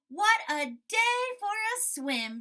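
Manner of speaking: high, loud and slow delight; cheerful Frog exclaiming; excited Frog. Acted. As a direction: savour the sunny day. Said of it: "said Frog".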